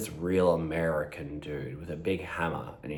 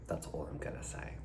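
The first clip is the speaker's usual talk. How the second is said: whisper; 3 semitones lower, 14 dB quieter than usual. Real, not acted. Secretive aside after teasing.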